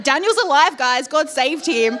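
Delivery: high, loud shout of good news; rally the crowd with the news; excited announcement. Shown as a demonstration. Preacher prompting the congregation to cheer, shouting. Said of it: "I thought you would have been like".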